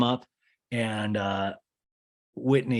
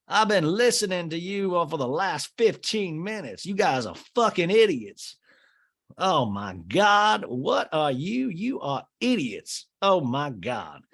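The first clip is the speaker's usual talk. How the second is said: higher, slowed, condescending imitation; mock snooty Whitney; 7 semitones higher than usual. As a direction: drag it out like a smug eavesdropper. Whitney mocking the two, quoted.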